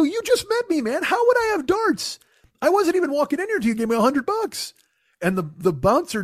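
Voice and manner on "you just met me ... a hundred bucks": very high-pitched retold protest; exasperated disbelief. Telling the ace he owns no darts, exasperated. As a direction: pitch it way up, indignant and incredulous.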